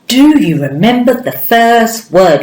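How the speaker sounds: ordinary talk, nothing marked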